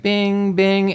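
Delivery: high pinging bing; video game bing. Retold or imitated. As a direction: chirp it like a game sound. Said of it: "where it's like".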